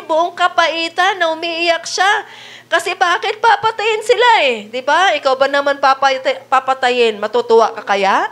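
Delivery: usual speaking voice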